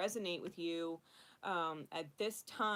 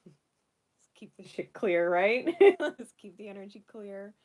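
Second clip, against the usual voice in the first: laughing loudly through the words, slowed right down; 3 semitones higher, 16 dB louder than usual. Real, not acted. Laughing loudly as she smudges for everyone's energy. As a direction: let real laughter spill over the line.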